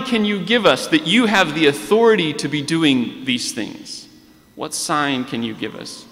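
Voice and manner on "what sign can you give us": ordinary delivery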